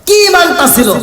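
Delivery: high, loud burst of wonder; awed exclamation. Real, not acted. Preacher marvelling at Bilal's faith to the crowd, high and loud.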